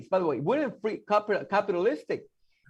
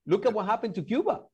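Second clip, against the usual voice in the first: ordinary talk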